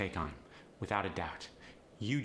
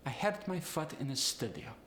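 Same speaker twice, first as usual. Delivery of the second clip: higher Polish accent, uh for oo; 4 semitones higher than usual. Shown as a demonstration. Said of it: "The oo sound goes to the uh sound".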